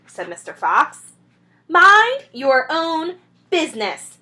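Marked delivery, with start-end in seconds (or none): 1.7-4.2 higher, loud, slow shriek; drunk rat shrieking. Acted. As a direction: snarl at Fox to back off. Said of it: "shrieked Rat".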